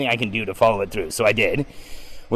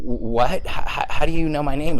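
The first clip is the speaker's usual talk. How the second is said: stuttering, higher and louder disbelief; stammering shock; 5 semitones higher, 4 dB louder than usual. Retold or imitated. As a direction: trip over the words, stunned he knows you. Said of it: "I was like, um, w-, w".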